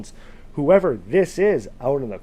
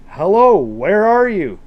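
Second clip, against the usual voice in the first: loud, higher-pitched searching call; yelling out; 6 semitones higher, 8 dB louder than usual. A narrator's reading. John again calls to whoever is in the corn, yelling. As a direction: call out loudly to someone unseen. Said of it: "he just yells out".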